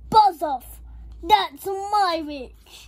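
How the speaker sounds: loud, forceful monster voice; horrible beast's yell. A narrator's reading. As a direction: bellow it, drawing out the last words. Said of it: "a yell and growled and a croak and a shriek".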